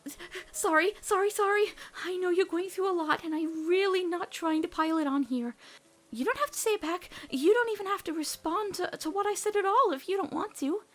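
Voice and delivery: embarrassed backpedalling after blurting I love you; flustered panic. Acted. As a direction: babble it out in a panic.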